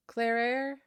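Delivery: ordinary talk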